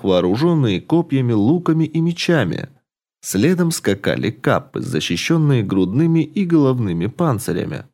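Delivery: usual speaking voice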